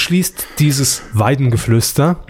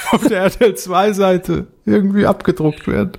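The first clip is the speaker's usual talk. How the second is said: higher voice, broken by real laughs; laughing request; talking through laughter; 5 semitones higher than usual. Real, not acted. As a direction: ask it while still cracking up.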